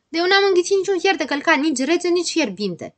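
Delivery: high protest, easing toward the end; shouting. Acted. Grăbilă insists he swallowed no iron, shouting. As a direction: start loud and high, then settle. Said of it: "strigă Grăbilă".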